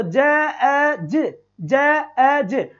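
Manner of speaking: "ज, ए, ज" slowly, each sound separate; deliberate phoneme-by-phoneme "ज, ए, ज". Shown as a demonstration. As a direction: split "जज" into ज, ए, ज. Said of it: "ये जो ए बोल रहा हूं मैं".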